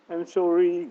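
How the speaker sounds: high, loud, pleading demonstration; pitiful apology. Shown as a demonstration. Teacher showing students how to say sorry, pitiful pleading. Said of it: "penuh belas kasihan".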